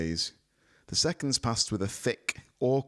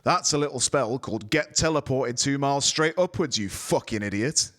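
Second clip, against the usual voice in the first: higher, louder gruff wizard voice, dry; dry intoning; 7 semitones higher, 6 dB louder than usual. A narrator's reading. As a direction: intone it with contempt. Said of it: "he intoned dryly".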